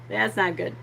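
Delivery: sympathise, then give in to giggles; real giggling. Real, not acted. Streamer pities Mikey, giggling. Still amused at poor Mikey.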